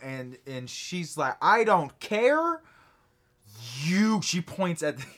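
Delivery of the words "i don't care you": loud, slow, furious snap; angry outburst. Acted. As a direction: yell at the other patron. Angry woman in theater.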